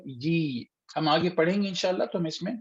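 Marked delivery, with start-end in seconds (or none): 0.1-0.6 "یی" held long; drawn-out vowel. Shown as a demonstration. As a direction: stretch "یی" into a long ee. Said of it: "یا سے آواز کھینچتی ہے".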